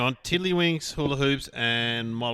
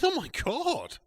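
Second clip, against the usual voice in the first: high, softer acted astonishment; mock amazement; 12 semitones higher, 5 dB quieter, slower than usual. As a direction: gasp it as the amazed barman. Joke barman amazed by talking dog.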